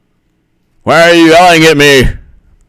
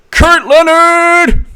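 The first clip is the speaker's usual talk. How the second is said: drawn-out high call; much slower than usual. Retold or imitated. Calling out a name.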